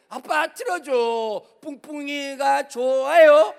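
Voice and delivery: put-on slow, loud childish whine of a son; imitating a little son. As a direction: whine it like a small boy begging dad. 아들 흉내, 아이 조르기.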